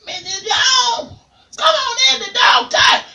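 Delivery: shrieking high parrot call; parrot squawk. Acted. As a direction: screech it like a bird at the window. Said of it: "Barry jumped up in the window and said".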